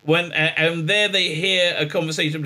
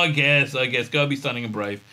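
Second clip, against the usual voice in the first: quick, half-hearted giving in; mock resignation; 2 semitones lower than usual. Reluctantly going along with the brave plan, mock resigned. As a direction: let the heroics deflate, resigned. Reluctant echo of heroic line.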